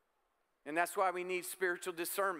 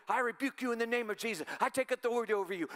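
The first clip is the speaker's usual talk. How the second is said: fast, higher, hammed-up rebuking of a demon; mock worked-up rebuke; 4 semitones higher, faster than usual. Preacher mocking a frantic rebuke of demons, hammed-up and fast. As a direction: rattle it off like an overexcited exorcist. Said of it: "and just get all worked up".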